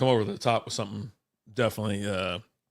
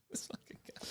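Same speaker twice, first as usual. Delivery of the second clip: whisper; 25 dB quieter, slower than usual. Real, not acted. Laughing aside at Rogan mention.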